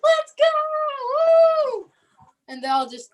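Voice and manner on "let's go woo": high, loud whoop of excitement; excited cheer. Retold or imitated. Kids cheering as they start playing, a high whoop. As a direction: cheer like a kid just swept into the music.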